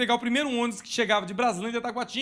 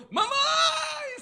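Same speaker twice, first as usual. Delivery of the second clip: put-on very high, crying shout of a lost child; desperate cry for mom; 3 dB louder than usual. Small boy left behind at the bus stop, crying shout for mom. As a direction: wail for your mother as the bus pulls away. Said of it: "saí correndo desesperado".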